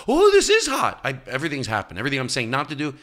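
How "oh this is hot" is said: very high, loud squeal; mocking guest. Mocking a guest at the towel ritual, a high loud squeal. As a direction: mimic a guest gushing about heat. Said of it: "don't go".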